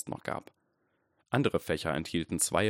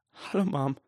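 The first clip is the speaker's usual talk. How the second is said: much higher, voice wavering near tears; trembling voice; 8 semitones higher than usual. A narrator's reading. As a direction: greet her, barely holding back tears. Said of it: "sagte Harry mit zitternder Stimme".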